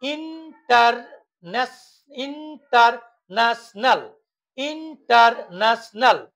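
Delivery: ইন্টারন্যাশনাল slowly, beat by beat; broken into syllables. Shown as a demonstration. Teacher demonstrating polysyllables, ইন্টারন্যাশনাল split in four. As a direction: sound out ইন, টার, ন্যাশ, নাল. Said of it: "তিনটির অধিক syllable থাকে".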